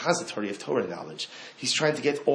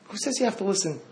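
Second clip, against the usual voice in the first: higher pitched, pointed repeat of "Who says you have to listen?"; raised challenge; 8 semitones higher, faster than usual. Shown as a demonstration. Rabbi challenging listeners with a higher pitched repeat.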